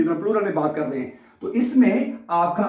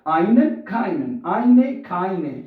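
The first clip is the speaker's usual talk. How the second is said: very slow, pairs drawn out side by side; slow model sounds; much slower than usual. Shown as a demonstration. Teacher contrasting articles for students, very slow. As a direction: stretch each word so the rhyme is heard. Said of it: "same sound pronounce हो रही है".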